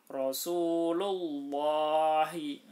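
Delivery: Rasulullahi, slow and loud, with a thick, heavy lam. Shown as a demonstration. Teacher demonstrating the thick lam, slow and loud. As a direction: recite Rasulullahi slowly with a full, heavy lam. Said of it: "dibaca tebal lamnya".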